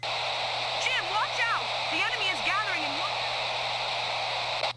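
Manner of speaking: high, loud urgent warning cries; urgent shout. Acted. Partner shouting a warning to Jim mid-fight, loud. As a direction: yell the warning across the battlefield.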